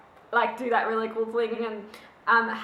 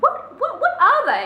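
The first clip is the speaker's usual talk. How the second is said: confused outburst; 11 semitones higher, 8 dB louder than usual. Retold or imitated. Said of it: "you're just like".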